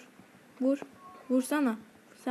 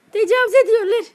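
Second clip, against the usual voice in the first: much higher and louder outraged complaint; outraged yell; 10 semitones higher, 10 dB louder, faster than usual. Real, not acted. Gamer surrounded by enemies, outraged yell. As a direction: cry out at the unfairness.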